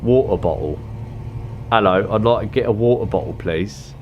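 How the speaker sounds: quick mock British accent; playful British accent with dropped consonants. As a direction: ask politely in a put-on British accent. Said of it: "It's such a good accent".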